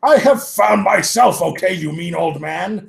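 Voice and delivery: hammed-up shout at Dumbledore; yelling. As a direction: yell it at the old man, high and loud. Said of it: "Hagrid yelled".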